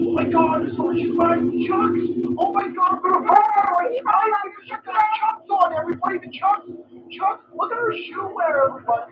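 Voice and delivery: high, breathless mock gushing; gushing fan parody. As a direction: gush like a starstruck fan over the shoes. Mocking gushing fans.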